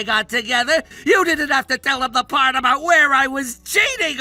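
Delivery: aggrieved, wailing complaint; childish whining. Acted. Man blaming rival for telling his dad, childish whining. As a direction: protest like a child denied a toy. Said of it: "like a child crying".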